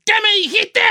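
high-pitched drawn-out yell; challenging shout. Pretend. Host acts out yelling outside for Alfredo to fight, challenging shout. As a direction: call out a rival from the street. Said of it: "y le grito así de afuera".

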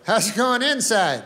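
mock-cheerful live report from outside; field reporter. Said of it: "guy outside, and he's like".

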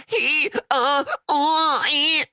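very high, loud mock whimpering; squealed kiddie whine, put on; whiny little boy. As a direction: imitate a little boy whining wordlessly. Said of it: "whiny little voice".

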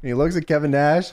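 ordinary talk

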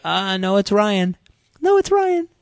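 very high mock teenage voice; imitated fifteen-year-old. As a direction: correct the caller in a high boyish voice. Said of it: "Imagine being fifteen years old".

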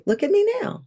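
"Look at me now" high and soft, rising on "me"; "Look at me now" lifted up and hushed, rising at "me". Shown as a demonstration. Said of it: "but it was a".

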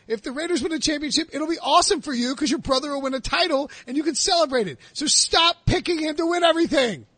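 high, exasperated rant pitched well up; worked-up rant. Real, not acted. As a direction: go off on him in total disbelief. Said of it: "I don't know why I went off on that".

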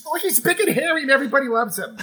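hint of a doting star, laugh in it. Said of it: "And she says".

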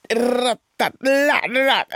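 high-pitched cry of pain, drawn out; acted scream. A narrator's reading. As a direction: yelp in pain, then stretch out a rattling cry. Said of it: "schreeuwde Purperhart".

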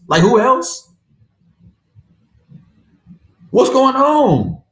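venting real frustration over the loss; mad as hell. Real, not acted. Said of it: "I'm really mad".

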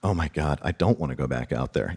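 a hushed, anxious drifter; mock dread. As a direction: mutter it in quiet dread. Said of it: "and I'm like".

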